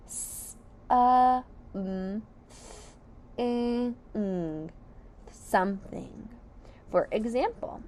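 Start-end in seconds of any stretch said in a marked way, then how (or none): 0.1-6.3 "S, uh, mm, th-, i-, ng" each sound apart, then "Something"; phonics sounds. Shown as a demonstration. Teacher blending a whole word for the class, sound by sound. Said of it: "let's sound it out all together".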